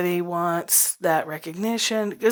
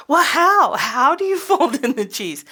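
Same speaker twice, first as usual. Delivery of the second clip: mock exasperated; 9 semitones higher, 8 dB louder than usual. Exasperated self in skit.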